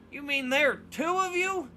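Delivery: put-on high, loud flustered old-man sputter; old man sputtering at the twins. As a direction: sputter in disbelief. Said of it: "the bearded man sputters".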